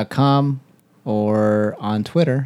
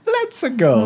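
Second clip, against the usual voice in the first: very high cartoon catchphrase; Mario; 12 semitones higher than usual. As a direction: call it like the game plumber. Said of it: "The voice of Mario".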